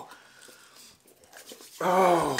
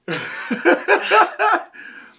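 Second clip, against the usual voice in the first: pained high laugh; 7 dB louder than usual. Real, not acted. Laughing in pain.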